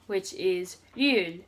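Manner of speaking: ryul said slowly, halfway between R and L; Korean ryul. Shown as a demonstration. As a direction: say ryul with the tongue on the L spot and an R colour. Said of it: "Ryul sounds a lot like an R mixed with an L".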